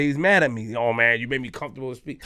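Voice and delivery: ordinary talk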